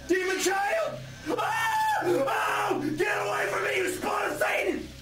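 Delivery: high-pitched horrified shriek; hammed-up scream of horror; panicked yelling. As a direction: yell high as if fending off a demon. Recoiling from a demon child.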